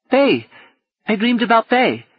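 put-on high little boy voice; child voice. A narrator's reading. Boy Gally telling Paul who he dreamed of, high child voice. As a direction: say it simply like a small child.

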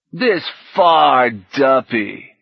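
very slow, sneering drawl; contemptuous drawl. A narrator's reading. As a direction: drag each word out with scorn. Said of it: "in a contemptuous goggle boy drawl".

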